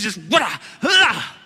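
loud effort cry while grabbing; mock heroic grunt-yell of a grab; effort shout. Boy grabbing the shark on the beach, shouting with effort.

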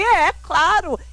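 high, emphatic mock-offended agreement; retold indignation. Answering her mother in the retelling, high and offended. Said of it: "eu fiquei ofendidíssima".

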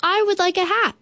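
high, eager bear voice; bear's reply. A narrator's reading. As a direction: answer high and keen as Bear. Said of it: "Then he replied".

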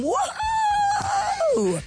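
high, drawn-out "woohoo"; gleeful high cheer; party-dad whoop. Acted. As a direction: whoop like a dad ready for fun. Acting out fun-loving dads.

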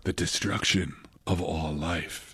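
low, slow, hushed doom; mock film gravity. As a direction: parody a solemn movie line, barely above a murmur. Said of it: "everybody was talking like this".

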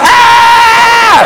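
put-on high shouted kid scream; panicked children's shriek. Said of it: "Just kids going".

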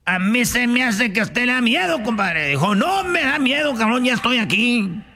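high indignant compadre. Acted. Hunters quarrel in the joke.

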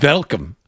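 "welcome" with a V sound, "velcome"; German-sounding welcome. Retold or imitated. Telling co-hosts what was heard, "welcome" said as "velcome". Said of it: "I thought German for a second".

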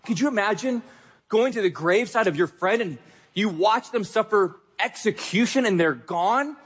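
usual speaking voice

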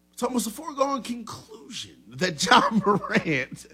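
Brian Windhorst impersonation. Acted. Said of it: "do my best Brian Windhorst impersonation".

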